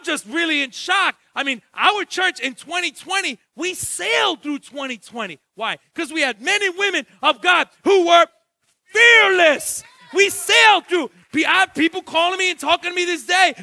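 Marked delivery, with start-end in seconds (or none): 5.9-13.5 loud, high preacher's call; full-force preaching. Acted. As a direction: preach it out to the whole church.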